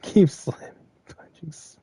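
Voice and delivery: a slow, urgent whisper to yourself. Real, not acted. Speaker mid-game urging themself on, a slow whisper. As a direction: hiss the order under your breath. Aside while gaming.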